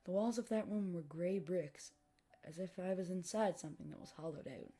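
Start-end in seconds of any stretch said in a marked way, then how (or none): none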